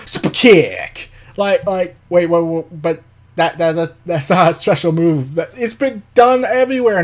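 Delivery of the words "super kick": low, drawn-out mock scream; imitating Marty Scurll. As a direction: stretch out the wrestler's catchphrase as a mock yell. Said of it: "Marty Scurll screaming out".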